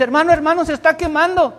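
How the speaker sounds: high, urgent alarmed plea; frantic girl. Retold or imitated. Girl running in telling the teacher of fire. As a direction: rush it like she just ran in. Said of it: "entra corriendo y me dice".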